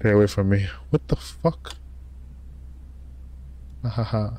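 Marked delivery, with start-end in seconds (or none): 0.9-1.8 breathless whispered shock. Real, not acted. Gamer whispering at a creepy scene, stunned. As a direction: whisper it, stunned by what's on screen. Creepy moment in horror game.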